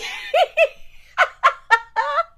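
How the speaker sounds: loud high-pitched laugh; real laughing fit; high cackle. Real, not acted. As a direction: burst out in a loud, squealing cackle. Laughs at own music taste.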